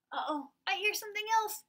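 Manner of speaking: high, quick, playful uh-oh; mock alarm. Teacher playfully startled by another animal sound.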